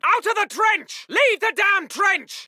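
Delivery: exasperated officer's bellow; urgent shouted order. Acted. Officer clearing chaps from the trench, exasperated shout.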